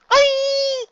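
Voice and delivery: long, very high, loud shriek; high-pitched squeal of shock; shrill scream. Acted. Luna hit by the auto brush, screaming.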